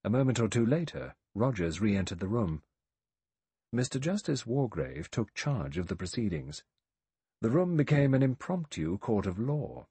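ordinary delivery

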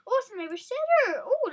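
ordinary talk

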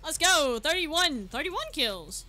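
loud, high celebration; real triumphant yelling; victory shout. Real, not acted. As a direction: cheer the milestone. Celebrating kill count.

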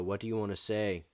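ordinary talk, nothing marked

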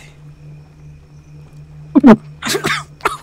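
loud burst of laughter; bursting out laughing. Real, not acted. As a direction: crack up loudly at a friend's mistake. Laughing at the mistake.

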